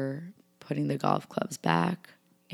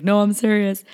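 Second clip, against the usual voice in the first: louder and higher, cutting the laughter dead; firm insistence; 6 semitones higher, 7 dB louder than usual. Retold or imitated. To her laughing brothers, insisting she's serious, raised and firm. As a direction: snap back that you mean it.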